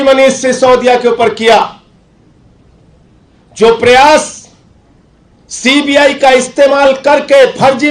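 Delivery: high, loud accusing speech; political oratory. Real, not acted. Accusing Modi's side before the press, forceful oratory.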